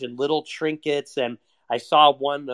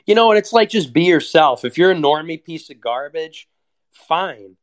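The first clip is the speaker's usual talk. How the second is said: loud rant; 2 semitones higher, 9 dB louder than usual. Real, not acted. Ranting at online posers, loud outburst. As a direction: yell it out in frustration.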